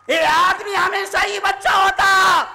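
high preacher's shout; loud proclamation. Shown as a demonstration. Loud repeat of the line.

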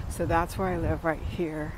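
ordinary delivery

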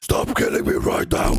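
raspy, breathy squawk of an angry bird; angry parrot voice. Acted. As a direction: hiss it like a menacing parrot. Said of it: "they actually got so scared because of that voice".

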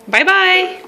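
high laughing sing-song bye-bye; baby talk. Pretend.

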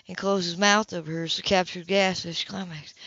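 plain reading voice

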